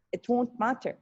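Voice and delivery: normal talking voice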